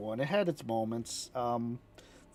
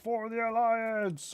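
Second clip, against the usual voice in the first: mock war cry; 8 semitones higher, slower than usual. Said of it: "they never yelled that".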